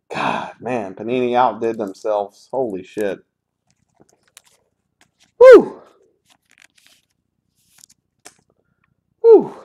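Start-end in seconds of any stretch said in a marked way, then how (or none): 5.4-9.5 a high, loud whoop of joy; excited whoop. Real, not acted. Collector whooping loudly over a great card pull. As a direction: cheer it out at the top of the voice.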